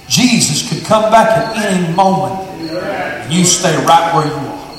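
low, soft, slowing sermon appeal; quiet altar call. Acted. Preacher softly warns listeners they could die tonight, low and quiet.

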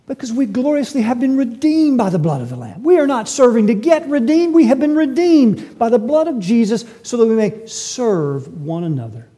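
impassioned sermon climax; full-force preaching. Acted. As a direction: drive the point home to the congregation.

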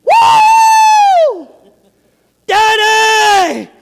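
a little daughter screaming to her daddy over her new doll; joyful scream. Retold or imitated. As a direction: squeal it out like a thrilled little girl. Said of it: "you could hear screaming".

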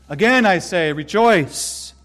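preacher's rousing call to joy; emphatic exhortation. Shown as a demonstration. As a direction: punch "rejoice." out to the congregation. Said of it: "hear this exhortation again and again".

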